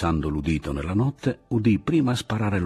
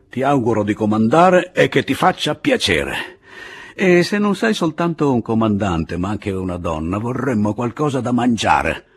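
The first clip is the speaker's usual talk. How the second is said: loud, high, scornful; sarcastic good wishes; 5 semitones higher, 6 dB louder than usual. A narrator's reading. Pablo hands the woman command, loud and sarcastic. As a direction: needle her with a loud mock blessing.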